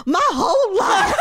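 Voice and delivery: laughing mock drama.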